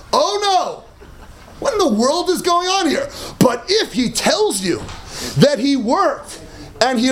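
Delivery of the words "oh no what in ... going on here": ordinary delivery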